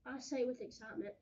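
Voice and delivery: ordinary delivery, nothing marked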